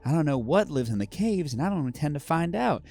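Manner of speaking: put-on friendly southern accent giving a warning. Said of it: "I'm doing a southern accent".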